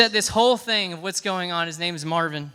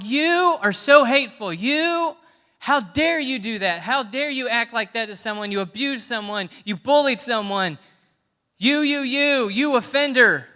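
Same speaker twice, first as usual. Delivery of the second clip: higher, louder finger-pointing rant; hammed-up scolding of the offender; imitating Christians angrily condemning an offender; mock accusation; 5 semitones higher, 5 dB louder than usual. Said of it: "We are sitting there going".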